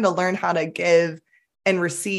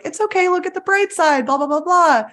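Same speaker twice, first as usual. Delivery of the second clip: mock cheery reassurance; 11 semitones higher than usual. Mocking well-meant fixing.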